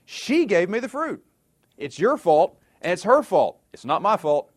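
high, indignant protest; shifting blame. Acted. Adam places the blame on Eve and God, protesting. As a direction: act out someone frantically passing the blame. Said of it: "he places the blame on Eve".

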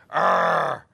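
loud growled arr; mock wolf growl. Pastor imitating the girl's growl, loud mock wolf growl. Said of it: "she growled at me".

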